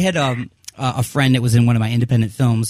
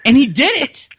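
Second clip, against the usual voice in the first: excited; 13 semitones higher, 5 dB louder than usual. Real, not acted. Amazed at friend's feat.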